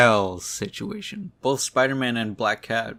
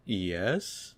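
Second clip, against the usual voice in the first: softer, with a sharp questioning rise; uncertain questioning yes; 6 dB quieter than usual. Retold or imitated. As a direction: say it as if asking. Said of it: "Yes, question mark?".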